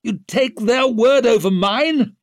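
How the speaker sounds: high, loud outraged question; furious protest, pitched right up; sudden fury. A narrator's reading. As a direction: explode with disbelief. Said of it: "in a sudden fury".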